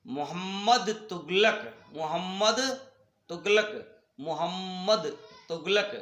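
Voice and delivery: "मोहम्मद तुगलक" word by word, with pauses between; careful pronunciation. Shown as a demonstration. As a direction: model "मोहम्मद तुगलक" for pupils, doubled m held. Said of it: "शब्दों का शुद्ध उच्चारण कीजिए".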